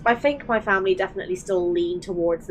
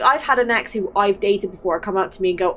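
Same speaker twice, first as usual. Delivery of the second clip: usual speaking voice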